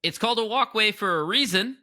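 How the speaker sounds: high, loud, scolding; mock-indignant yell to people stopping in a walkway; scolding call. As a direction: tell the blockers off. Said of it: "I go".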